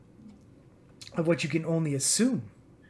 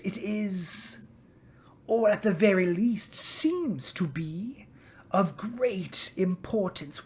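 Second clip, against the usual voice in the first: softer, breathy whisper of a suspicious dragon; 5 dB quieter, slower than usual. Acted. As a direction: dragon whispering menacingly to the goblins. Dragon questions the goblins.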